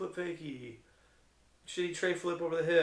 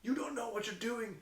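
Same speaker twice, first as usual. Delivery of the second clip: retold indignation, pitched high; livid dad; 8 semitones higher than usual. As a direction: imitate an outraged dad in a high voice. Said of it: "the dad was livid".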